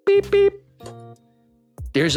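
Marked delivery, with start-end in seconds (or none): 0.1-0.5 very high, short twin beeps; squeaky mimicked alert tone; electronic beeping. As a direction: imitate a device alarm beeping. There's a beeping noise.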